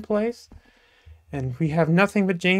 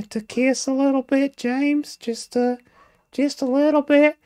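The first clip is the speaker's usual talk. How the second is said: put-on high, prim falsetto, like a coy young lady; 8 semitones higher than usual. Jamesina speaking to James, in a high falsetto lady voice. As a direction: play a coy Southern belle up high.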